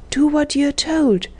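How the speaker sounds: fierce, measured command; fierce whisper. A narrator's reading. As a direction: order it sternly, drawing out each word. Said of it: "so fiercely that she said no more".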